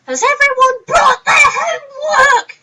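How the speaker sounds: high, loud, slow shouted teacher voice; mock shouting teacher. To the class, a hammed-up shouting teacher. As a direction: bellow it like a shrill schoolteacher.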